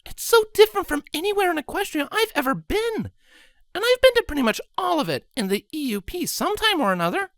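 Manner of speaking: very high, fast gushing voice; excited young mare. A narrator's reading. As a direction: gush on, barely pausing. Scarlet chatters excitedly.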